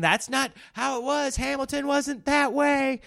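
hammed-up pedantic moviegoer; mock know-it-all heckler. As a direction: pretend heckler correcting the film, high mock voice. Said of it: "Are you out there just saying".

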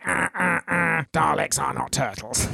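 slow, drawn-out monster robot voice; slowed-down Dalek. Acted. Co-host mocking a lazy robot effect, slowed-down Dalek voice. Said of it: "slowing down the voices going".

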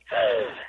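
a sharp, breathless gasp of fake shock; mock shocked gasp. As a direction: gasp as if scandalised. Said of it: "now they're like".

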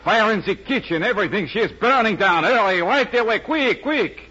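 panicked French chef on the phone, pitch climbing; phony French accent. Acted. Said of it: "my phony French accent".

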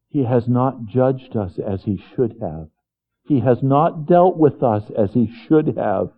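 ordinary delivery

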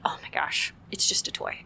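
whispered, brushing-off tone; a tired mom brushing off tears; dismissive whisper. Shown as a demonstration. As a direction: wave it away under your breath. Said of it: "easier to just be like".

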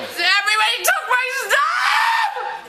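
high, slow, drawn-out shout; real, anguished outcry; distraught yell. Real, not acted. Passenger yells about lost things.

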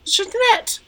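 high-pitched swooning name call; melodramatic adoration. Acted. [name] to Jeanette in a bonding role-play, high and gushing. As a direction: cry the name like a soap heroine.

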